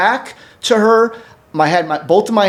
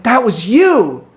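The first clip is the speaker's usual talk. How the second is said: slow, high, louder accusation; retold stunned recognition; 8 semitones higher, 5 dB louder, slower than usual. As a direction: call her out in amazed recognition, drawing it out. Retold shock at recognizing her.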